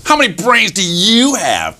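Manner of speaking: high, swooping challenge on YOU; boastful fox. Acted. Fox challenges a rabbit about brains, boastful. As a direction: quiz the rabbit like a show-off fox. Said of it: "And the fox says".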